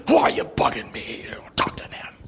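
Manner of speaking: hushed, breathy retold retort; whispered retelling. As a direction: say it again under your breath, as if confiding.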